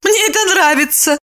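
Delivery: very high indignant woman's voice. A narrator's reading. Lipochka takes offence at being insulted, indignant. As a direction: exclaim in high-pitched indignation. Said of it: "возмутилась Липочка".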